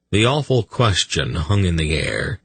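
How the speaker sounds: ordinary delivery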